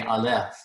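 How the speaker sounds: Aleph stressed as a-LEPH; stress on last syllable. Shown as a demonstration. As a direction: put the weight on the end of Aleph. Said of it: "accent's gonna be on the last syllable".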